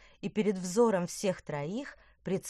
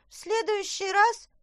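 high squeaky voice; 6 dB louder than usual. Acted. Sparzhinzon pleading.

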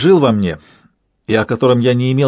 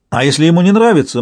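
ordinary delivery, nothing marked

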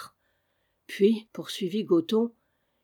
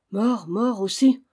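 slow, slightly louder, heavy with grief; stricken grief; 2 semitones higher, 5 dB louder than usual. A narrator's reading. Jude hearing his young master is dead, stricken and slow. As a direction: let the words fall like a blow. Servant learns the child died.

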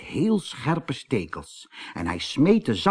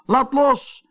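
high, louder cry; storybook shout; 14 semitones higher, 5 dB louder than usual. A narrator's reading. Kees stuck to the doll, shouting to be freed. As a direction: shout it out, pitched far up. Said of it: "schreeuwde Kees Konijn".

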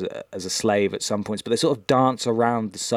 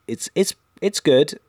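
ordinary talk

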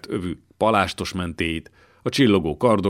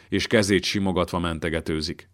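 ordinary delivery

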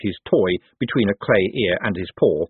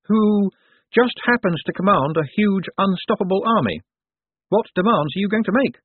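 lightly voiced reporter, higher pitch; reporter Saccharissa, probing; 11 semitones higher than usual. A narrator's reading. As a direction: press the question in a higher voice. Reporter questions Moist publicly.

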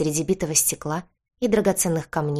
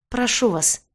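higher, mildly wailing appeal; wailed plea; 5 semitones higher than usual. A narrator's reading. Injured old man begging his tormentor, a mild wail. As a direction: beg with a restrained wail. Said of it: "снова взвыл старик".

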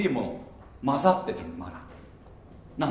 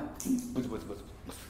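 very fast breathy muttering; whispered grumbling; 8 semitones lower, 16 dB quieter, much faster than usual. Retold or imitated. Preacher mimics a grumbler, whispering fast. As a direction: rattle off a mumbled complaint in a whisper. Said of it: "ブツブツ言うな".

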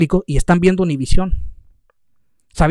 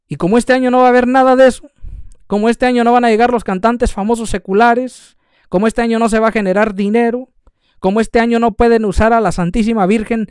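heated preaching; 5 semitones higher than usual. Acted. Preacher rails at those profiting from the Virgin, heated and fast. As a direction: hammer the point home at speed. Preaching builds to full force.

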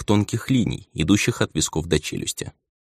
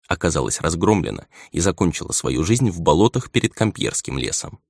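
ordinary delivery, nothing marked

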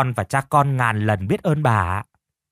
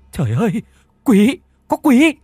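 terrified scream; 11 semitones higher, 7 dB louder than usual. A narrator's reading. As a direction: scream in horror at the ghost. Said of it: "Tuyền thốt lên".

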